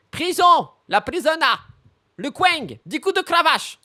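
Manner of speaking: mock sentencing.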